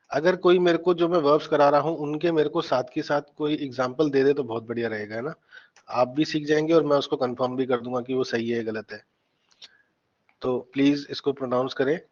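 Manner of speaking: ordinary talk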